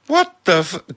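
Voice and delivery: loud, high exclamation; startled high outburst; shocked cry. A narrator's reading. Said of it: "Chand cried".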